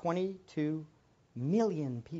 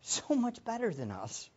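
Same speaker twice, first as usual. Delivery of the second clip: normal talking voice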